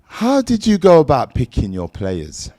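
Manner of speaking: usual speaking voice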